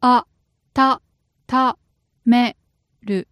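broken into single syllables. Shown as a demonstration. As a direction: say each syllable apart. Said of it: "Break it down".

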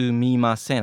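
"sumimasen" with every syllable fully sounded; full careful form. Shown as a demonstration. Teacher showing the form rarely heard in town, fully pronounced. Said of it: "I never usually hear people say".

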